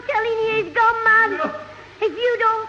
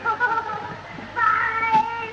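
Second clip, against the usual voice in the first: calling out; 4 semitones higher, much slower than usual. Acted. Counting off a race.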